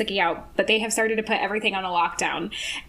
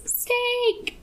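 mock warning cry; 12 semitones higher, 4 dB quieter than usual. Sookie warning Eric of attacker, high mock cry. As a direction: squeal it like a held-back shout.

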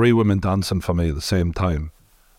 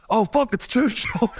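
mock startled stranger; 12 semitones higher, slower than usual. Retells a stranger's shocked remark.

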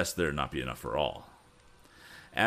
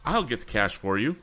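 put-on raised, determined young inventor's voice; ringing declaration; 6 semitones higher, 5 dB louder than usual. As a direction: declare it out to the whole room, pitched up. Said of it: "his voice rang out through the room".

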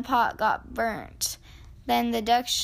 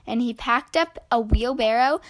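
ordinary delivery, nothing marked

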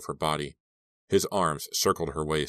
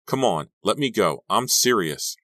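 higher, louder insistence; raised, insistent protest; urgent plea; 5 semitones higher, 5 dB louder than usual. Acted. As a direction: demand release, a touch panicky. Captive demands to be released.

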